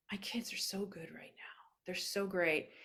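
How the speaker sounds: soft, breathless retold whisper, close to tears; tearful whisper. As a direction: whisper it as if choking up with joy. Tearful friend retelling her reply.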